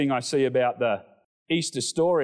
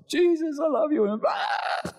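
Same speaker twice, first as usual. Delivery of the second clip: high, breaking cry of love; 12 semitones higher than usual. Retold or imitated. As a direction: sob out the words in worship. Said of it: "I was a blubbering mess".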